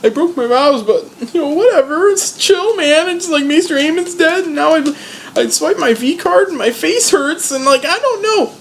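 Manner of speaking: very high slacker whine; whiny laid-back Sam. Retold or imitated. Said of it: "he's like".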